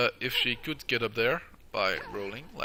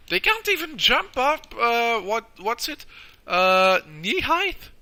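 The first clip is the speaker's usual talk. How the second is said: surprised; 11 semitones higher, 9 dB louder, slower than usual. Real, not acted. Baffled at enemies who can't jump.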